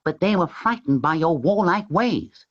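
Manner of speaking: high, gentle cat voice; talking cat. A narrator's reading. Tom the cat addressing the Sultan, high and gentle. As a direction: speak as a polite, wise cat.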